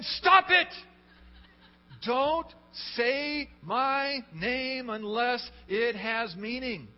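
high, booming command, drawn out after the first cry; God's thundering rebuke. Acted. Preacher voicing God rebuking empty use of his name, thundering shout. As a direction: shout it down from heaven, stretching the words. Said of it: "And God in heaven is saying".

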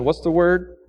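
ordinary delivery

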